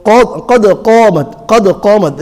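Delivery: full-mouthed back q on قَدْ and قَامَتْ; big qaf. Shown as a demonstration. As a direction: round out قَدْ قَامَتْ, heavy "qod qoomat". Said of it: "it's big qaf".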